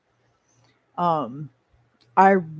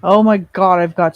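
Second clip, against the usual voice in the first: exasperated; 11 dB louder than usual. Real, not acted. Overwhelmed by too many items.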